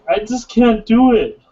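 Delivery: high, slow whiny protest; Manny. Retold or imitated. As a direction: whine it like a shy guy giving up. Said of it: "he's like".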